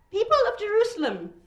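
high, loud address to a crowd; strained high-pitched oratory in a wrong accent; weedy, thin shout. A narrator's reading. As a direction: shout it thinly from a balcony. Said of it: "he shouts".

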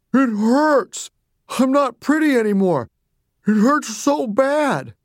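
high, pained wail; tearful Mommy. A narrator's reading. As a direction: cry pitifully through the pain. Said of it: "Mommy started to cry a little".

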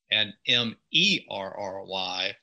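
ordinary delivery

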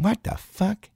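high, hushed mock indignation; indignant mom. Mom to Snickers-grabbing kid, high hushed indignation. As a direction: squeak it out softly, aghast. Mom almost going off, acted.

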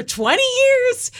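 high, loud, slow outrage; incredulous. Real, not acted. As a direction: stretch it out in disbelief. Outrage at the joke.

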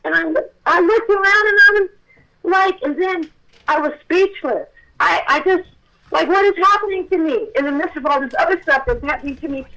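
overwhelmed exclaiming. Real, not acted. Telling listeners how the vanished angel stunned her, loud and overwhelmed. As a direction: pour it out, still reeling from the shock.

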